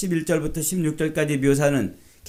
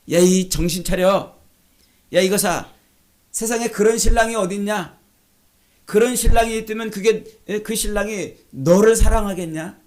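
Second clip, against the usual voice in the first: loud, higher jeering scoff; scornful mock taunt; 6 semitones higher, 7 dB louder than usual. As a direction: taunt the bride as a fool. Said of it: "이 말이 조롱하는 표현이에요".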